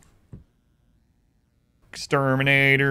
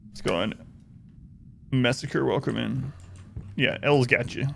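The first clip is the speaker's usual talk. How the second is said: normal talking voice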